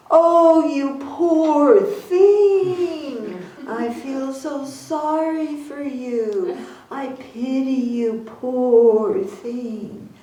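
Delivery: high, slow, syrupy mock pity; gushing pity. Teacher mocking fake compassion, slow syrupy pity. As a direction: drip with overdone sympathy. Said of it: "That is not compassion".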